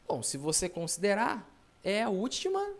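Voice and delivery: usual speaking voice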